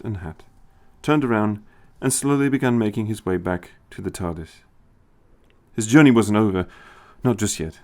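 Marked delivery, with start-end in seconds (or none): none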